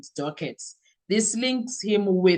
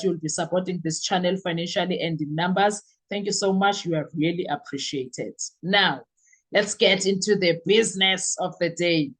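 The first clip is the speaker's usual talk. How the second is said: ordinary talk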